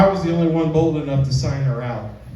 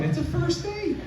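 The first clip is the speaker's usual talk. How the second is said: squeaky polite protest; 11 semitones higher, 9 dB quieter than usual. Retold or imitated.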